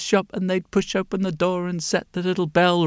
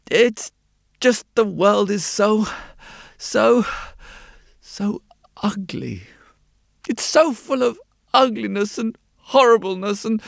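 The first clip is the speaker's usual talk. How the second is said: higher, distressed character voice with halting pauses; troubled mole-like man; 5 semitones higher than usual. A narrator's reading. Mole-like man confessing to the glasses maker, distressed. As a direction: struggle to get the words out, pitched up.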